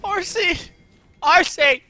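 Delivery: frantic shout. Real, not acted. Gamer calling his team in panic, high and loud. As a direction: yell for the horse, high and loud.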